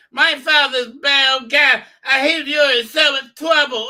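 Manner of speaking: put-on high, loud, slow bratty child voice; precocious girl. Young princess taunting her captors, bratty and high. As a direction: taunt like a smug little girl. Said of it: "she's a precocious girl, said".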